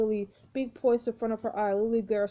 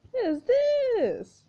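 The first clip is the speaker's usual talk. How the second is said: startled high cry; 13 semitones higher, 6 dB louder, much slower than usual. Acted. Bird lands on Lily's shoulder.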